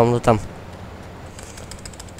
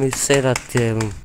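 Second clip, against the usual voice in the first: ordinary talk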